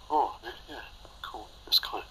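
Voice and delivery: soft, whispered mumble; mumbled imitation. Imitating the mumbling friend, soft whispered mumble. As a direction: mumble it half to yourself. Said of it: "And he was like".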